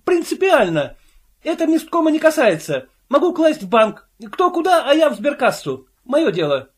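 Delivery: defiant. A narrator's reading. Invisible man defying the committee, high and defiant. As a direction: insist stubbornly it's his business.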